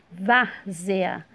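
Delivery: "Várzea" split up, strong long VÁR; stress on first syllable. Shown as a demonstration. Teacher showing the tonic syllable, "Várzea" with stressed VÁR. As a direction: hit VÁR in "Várzea", then place the ending apart. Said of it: "sílaba tônica vá".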